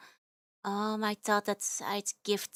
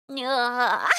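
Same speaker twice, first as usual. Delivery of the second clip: short loud anguished wail; a playful cry of despair; loud mock cry; 6 dB louder than usual. Real, not acted. Wail over broken standee.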